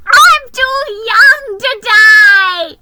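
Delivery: high, loud, slow panicked wail; terrified. Acted. Frightened mouse on the sleigh, wailing high. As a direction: wail in terror of flying.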